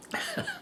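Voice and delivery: brief high-pitched giggle; a quick, real little laugh; short laugh. Real, not acted. Amused at clinic in barn.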